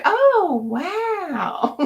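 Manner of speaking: high, amazed "oh, wow"; high, bright wonder; delighted wonder. Shown as a demonstration. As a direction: marvel at it openly. Modeling trusting response.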